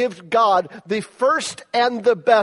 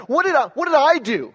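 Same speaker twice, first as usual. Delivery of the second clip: incredulous protest; 7 semitones higher than usual. Retold or imitated. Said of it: "I was incredulous. I was mad".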